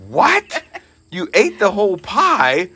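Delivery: half-laughing, astonished shout; shocked disbelief. Retold or imitated. Retold shocked reaction, shouting.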